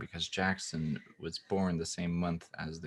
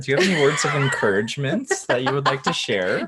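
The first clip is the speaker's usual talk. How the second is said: higher and louder through real laughter; laughing question; 8 semitones higher, 10 dB louder than usual. Real, not acted. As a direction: ask it while still cracking up. Laughing while asking the question.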